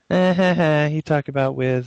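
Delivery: mock schoolyard teasing, snicker then jeer; childish taunt. Recalling childhood teasing about a word, mock kid snicker. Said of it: "something that we made fun of when we were little kids".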